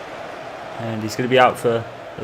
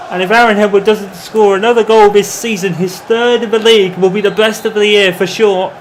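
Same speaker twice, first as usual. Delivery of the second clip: shouting, high-pitched play-by-play; goal-call commentary, loud and high; excited goal call; 6 semitones higher, 4 dB louder than usual. Acted. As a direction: roar the goal like it's the strike of the season.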